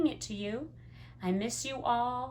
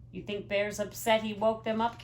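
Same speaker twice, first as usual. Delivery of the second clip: ordinary delivery, nothing marked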